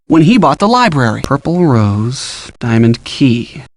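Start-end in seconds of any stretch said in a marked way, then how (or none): 1.2-3.6 slow, low and measured; slow, lowered puzzling-it-out voice; pondering a clue. Acted. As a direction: read the cryptic words out slowly, dropping the pitch. Puzzling over a cryptic clue.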